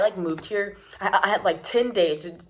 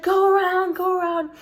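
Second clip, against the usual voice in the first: urgent hurry-up; 11 semitones higher than usual. Retold or imitated. Urgent helper hurrying her.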